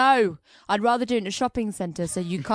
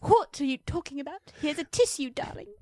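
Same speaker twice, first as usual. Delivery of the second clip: higher, put-on girlish voice; daughter voice; 11 semitones higher than usual. Daughter asks her father why the flowers were taken, in a higher girlish voice. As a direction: play a bewildered young daughter.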